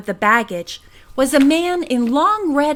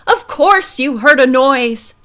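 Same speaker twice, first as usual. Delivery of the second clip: indignant; 5 dB louder than usual. A narrator's reading. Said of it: "the indignant man repeated".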